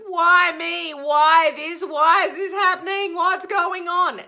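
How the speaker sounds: loud, high, frantic 'why me' wail; hammed-up distressed outburst; anxious whining. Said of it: "You're not stuck in the emotion of".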